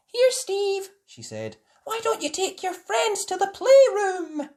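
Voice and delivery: kindly nurse offering the wheelchair; Nurse Rabbit's voice. A narrator's reading. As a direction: pitch the nurse way up.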